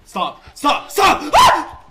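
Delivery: from sharp plea to loud high scream; real terrified scream; building to a scream. Real, not acted. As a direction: panic rising to a shriek. Chased by monster, building scream.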